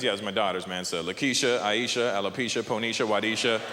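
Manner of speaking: low-voiced imitation of a dad; friend, proud father. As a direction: drop deep and list the daughters' names. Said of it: "one of my friends".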